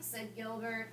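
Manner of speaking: usual speaking voice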